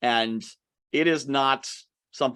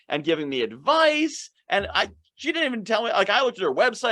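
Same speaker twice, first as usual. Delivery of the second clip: higher, bubbly voice of a delighted client; a client raving to a friend about a great networking call, gushing; gushing praise; 7 semitones higher than usual. Retold or imitated. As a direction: gush like a thrilled fan.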